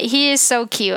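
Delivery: usual speaking voice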